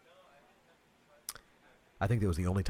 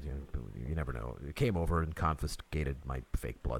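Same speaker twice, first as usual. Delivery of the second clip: ordinary talk, nothing marked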